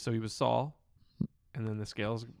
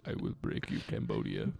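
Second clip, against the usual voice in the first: barbarian. Pretend. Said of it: "Dolph Lundgren".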